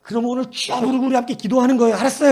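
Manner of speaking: loud, high, upbeat call; rallying the kids. Retold or imitated. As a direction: pump up a room of children. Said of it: "했더니".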